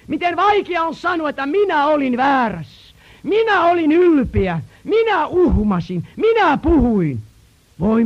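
high, loud preaching, fast on the confessions; impassioned sermon appeal; preaching at full force. Acted. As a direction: drive home how hard it is to confess. Preacher urging the congregation.

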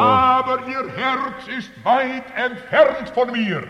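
high, loud, slow pulpit accusation; thundered scripture quote. Retold or imitated. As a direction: proclaim the rebuke at full force. Preacher quotes Jesus's accusation. (donnernd und langsam verkündete Anklage)

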